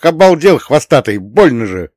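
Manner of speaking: loud, pained telling-off; heated scolding. A narrator's reading. Master scolding the cat who clawed him, loud and cross. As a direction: scold loudly, starting high and dropping. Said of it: "выругался я в сердцах".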